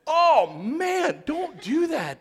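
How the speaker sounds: gasp, then a high, loud "Oh, man"; startled dad stopping the kid; alarmed gasp and shout. Retold or imitated. Dad reacting to ear jam.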